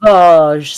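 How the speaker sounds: "Savage" punched loud with a long -AHZH ending; mock French pronunciation. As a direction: lean hard on a French-style "Savage". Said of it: "it's pronounced savage".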